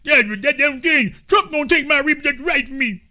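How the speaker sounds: high, garbled ranting caricature; hammed-up clueless complainer; mocked commenter. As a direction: pitch it up and protest in a muddle. Mocking caricature argues with host.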